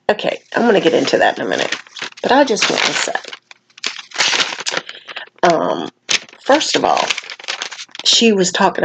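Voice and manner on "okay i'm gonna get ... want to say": usual speaking voice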